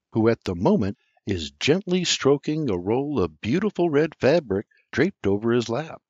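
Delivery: ordinary delivery, nothing marked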